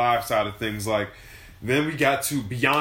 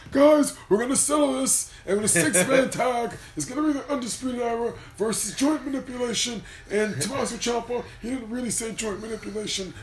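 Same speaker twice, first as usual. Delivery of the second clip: lightly voiced William Regal, pitched higher, no accent; a dry, half-hearted William Regal voice, higher up; a hint of William Regal announcing a tag match; terrible William Regal impression; 10 semitones higher than usual. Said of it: "That's my terrible William Regal impression".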